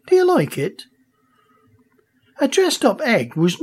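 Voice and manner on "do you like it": mum's voice. A narrator's reading. Mum voiced in story.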